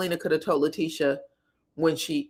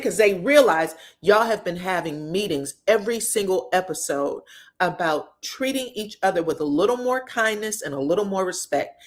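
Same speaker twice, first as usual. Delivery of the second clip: ordinary delivery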